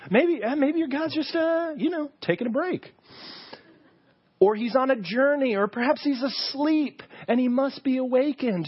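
high sarcastic teasing; mocking. Elijah ridiculing the priests about their sleeping god, mocking. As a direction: needle them in a high mocking voice. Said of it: "he just keeps mocking them".

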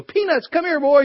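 high, sing-song call to a dog. Retold or imitated. Calling the dog in anecdote.

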